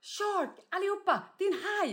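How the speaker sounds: excited high mock yell; re-enacted yell. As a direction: act out alerting the whole group. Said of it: "du skrek galen".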